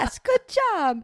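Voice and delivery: high, slow, sing-song praise; praising toddler. Retold or imitated. Mom praising her son for barking, high sing-song. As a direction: cheer on a little one gently.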